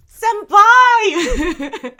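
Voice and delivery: very loud, high cry of the name; appalled shout. Real, not acted. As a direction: holler the name in shocked sympathy, then laugh. Exclaiming at viewer after the story.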